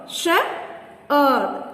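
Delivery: "Shepherd" in two beats, Shep, herd; long pause in the middle of "Shepherd"; syllable by syllable. Shown as a demonstration. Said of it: "Two syllables".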